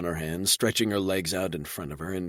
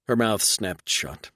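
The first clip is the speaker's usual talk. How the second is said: plain reading voice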